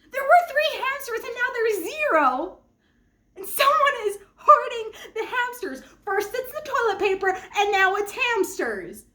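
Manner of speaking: high, loud, laughing indignation; worked-up rant. Real, not acted. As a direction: vent it like the last straw. Ranting about hamster hoarding.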